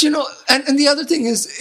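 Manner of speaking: usual speaking voice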